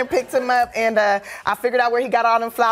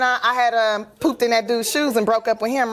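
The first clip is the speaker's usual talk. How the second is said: usual speaking voice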